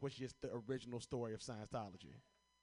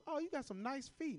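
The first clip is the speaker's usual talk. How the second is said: mom's voice; 12 semitones higher, 5 dB louder than usual. Retold or imitated. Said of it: "My mom looks at me".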